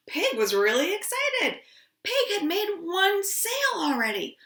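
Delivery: plain reading voice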